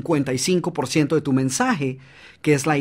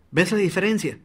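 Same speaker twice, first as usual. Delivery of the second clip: ordinary talk, nothing marked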